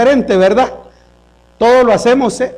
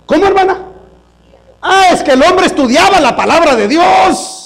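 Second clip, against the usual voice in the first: higher, louder sermon voice pressing the congregation; full-force preaching; 6 semitones higher, 3 dB louder than usual. Acted. Pastor urging the congregation to check their Bibles, preaching loud. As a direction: drive the question home.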